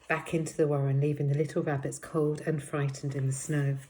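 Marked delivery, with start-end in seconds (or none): none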